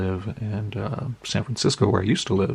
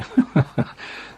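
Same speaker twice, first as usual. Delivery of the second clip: light, higher-pitched chuckle; short laugh; 7 semitones higher than usual. Real, not acted. Reading a friend's lake stationery, amused chuckle. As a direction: chuckle to yourself.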